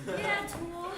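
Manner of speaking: usual speaking voice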